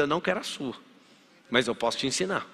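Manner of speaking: ordinary talk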